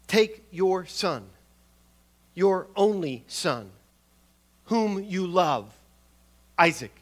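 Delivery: slow, halting delivery, each phrase set apart; staccato for emphasis. Shown as a demonstration. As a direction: weigh out "Take your son", "your only son", "whom you love", "Isaac" with a pause after each. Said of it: "staccato, a kind of halting pronunciation".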